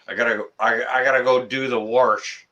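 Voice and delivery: "warsh" said with an r sound, like warsh; imitating a local who says "warsh" with an r; dialect "warsh". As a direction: slip an r into "warsh" before the sh. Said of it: "there's folks up here who say".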